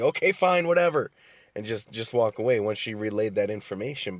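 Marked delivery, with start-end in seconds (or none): 0.0-1.1 higher, louder, curt brush-off; imitating dismissive mom. Mom closing a family shouting match, dismissive "whatever". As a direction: mimic the mom ending the argument with a shrug. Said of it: "and then she'd be".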